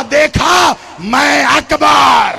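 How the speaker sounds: loud, high, slow and boastful; God proudly showing off His warrior; proud declaration. Acted. God proudly tells the heavens about Abbas, loud slow boast. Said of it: "तकब्बुर से कहा".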